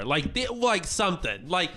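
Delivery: ordinary talk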